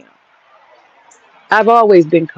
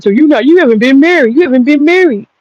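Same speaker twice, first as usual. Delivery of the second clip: heated retort; 6 semitones higher than usual. Real, not acted. Angry rant at critic.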